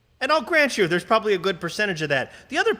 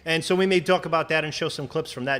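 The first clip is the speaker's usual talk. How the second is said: normal talking voice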